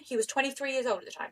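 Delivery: ordinary talk, nothing marked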